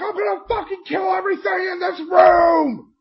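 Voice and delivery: very high, loud shout; furious battle cry. Retold or imitated. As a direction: burst in roaring a threat. Acted battle cry kicking in door.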